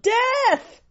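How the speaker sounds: high, loud yell. Acted. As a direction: call out the name sharply. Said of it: "She yelled".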